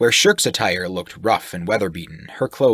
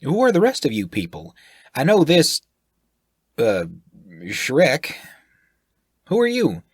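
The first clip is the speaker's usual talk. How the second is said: higher and louder, nosy and blunt; brash sailor voice; 6 semitones higher, 5 dB louder than usual. Acted. The sailor asks the group who they are, brash and loud. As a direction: fire questions like a brash sailor.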